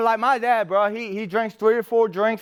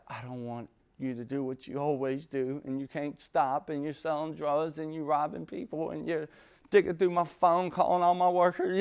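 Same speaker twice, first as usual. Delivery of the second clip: soft teary plea; 3 semitones lower, 8 dB quieter than usual. Retold or imitated. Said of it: "and she's like".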